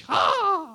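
high mock devil cry; a little sound effect for the devil. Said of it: "We get sound effects too".

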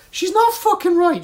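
high, loud, slow outraged protest; exasperated. Real, not acted. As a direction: insist hard that she's wrong. Ranting about Iris.

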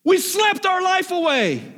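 high, rising preacher's cry; preaching at full force. Acted. Preacher pressing the church to work while light shines. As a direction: preach it up to the peak.

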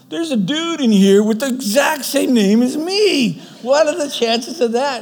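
imitating naive excitement; caught up in the moment. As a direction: retell it as an oblivious, excited guy marvelling at a coincidence. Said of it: "so caught up in the moment".

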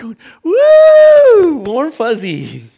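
high, loud gleeful whoop; mock a wife's thrilled swoon, drawn out; whooping with delight, playfully exaggerated; imitated delighted wife. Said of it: "But she's like".

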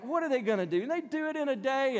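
high, sneering mock voice. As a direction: scoff at them like a bully. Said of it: "And Sanballat's like".

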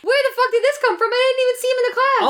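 high, disbelieving outburst; can't believe what you're watching; incredulous. Retold or imitated. Critic baffled that the hunk speaks French, high disbelief.

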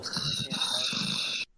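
breathy, hushed imitation of a dismissive guard; mock guard voice. Friend mocking the game's guards to his co-host, hushed mock voice. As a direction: toss it off like a smug guard.

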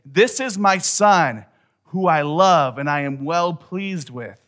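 big heavenly announcement, slow and high; Father's proclamation. Acted. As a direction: proclaim it slowly over the crowd. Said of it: "voice of the Father say".

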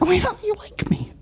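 high falsetto echo of a plea; thin, soft mock-cartoon voice; Olga. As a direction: mimic the wounded sister up high. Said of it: "Olga from Hey Arnold".

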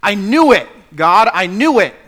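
loud, angry outburst at God; erupting in anger. Acted. Preacher voicing Jonah's angry outburst at God. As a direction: Jonah blowing up, feeling tricked. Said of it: "he erupts in anger".

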